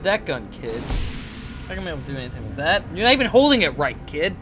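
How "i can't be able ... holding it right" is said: normal talking voice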